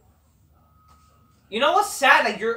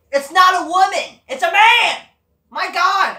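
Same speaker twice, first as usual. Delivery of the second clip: higher, louder angry yelling; furious shout; 10 semitones higher, 9 dB louder than usual. Real, not acted. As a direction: rant at full volume in disgust. Furious rant about a wrestler.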